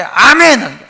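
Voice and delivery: mimicked unthinking congregational amen; imitating an over-ready response; mock eager amen. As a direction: belt out a drawn amen on cue. Mimics congregation answering a silly question.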